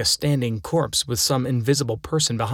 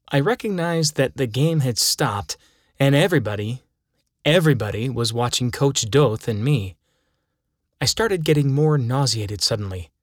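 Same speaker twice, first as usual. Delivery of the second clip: ordinary delivery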